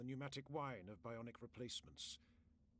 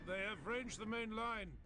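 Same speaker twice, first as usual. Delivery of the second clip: raised, higher call of alarm; urgent warning; 8 semitones higher, 5 dB louder than usual. A narrator's reading. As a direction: shout the warning as if shells are landing. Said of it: "It felt vile speaking those words".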